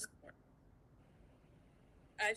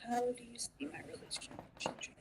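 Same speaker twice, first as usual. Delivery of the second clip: murmured to self; 3 semitones higher, 5 dB quieter than usual. Real, not acted. Reader repeats the question quietly.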